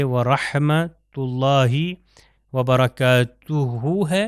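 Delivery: وبرکاتہ stretched very slowly, ending on ہ; slow careful recitation. Shown as a demonstration. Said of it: "یہاں پر دیکھیے عین آئے".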